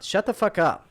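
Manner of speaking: ordinary delivery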